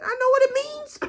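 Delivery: high-pitched retort breaking into laughter; laughing interruption. Retold or imitated. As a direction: cut in, exasperated but laughing.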